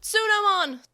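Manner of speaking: very loud, high scream of a name; anguished scream. A narrator's reading. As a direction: cry out in despair. Children screaming partners' names.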